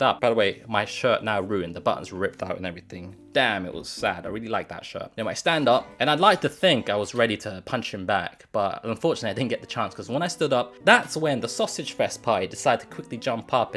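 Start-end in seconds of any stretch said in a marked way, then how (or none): none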